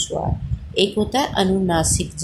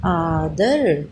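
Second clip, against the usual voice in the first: आदर stretched very slowly; slow blending; 3 semitones lower, much slower than usual. Shown as a demonstration. Teacher to children, blending आदर very slowly. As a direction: draw आदर out for the class. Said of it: "teacher blends the word".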